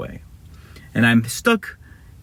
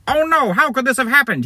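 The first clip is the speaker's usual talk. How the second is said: high, hammed-up dismay; surprised villain; 13 semitones higher than usual. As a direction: cry out high in mock shock. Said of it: "That's how he sounded surprised".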